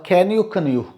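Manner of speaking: ordinary talk, nothing marked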